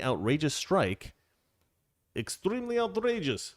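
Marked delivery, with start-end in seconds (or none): 2.2-3.5 mock outraged official. Mocking Russia's statement for his co-host, hammed-up high outrage. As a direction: ham up the Kremlin's indignation, pitched high. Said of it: "That's how they say it".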